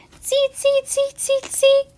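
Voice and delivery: very high monkey squeaks; chattering monkeys. A narrator's reading. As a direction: squeak back in a tiny monkey voice. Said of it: "back at him and said".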